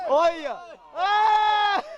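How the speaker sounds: shouting. Real, not acted. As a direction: yell it out. Shouting as the car plunges.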